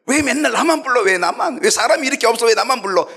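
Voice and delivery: high, indignant imitation of a grumbler; mock complaining. Kitchen volunteer protests being the only one called, mock complaining. Voicing members complaining when recruited.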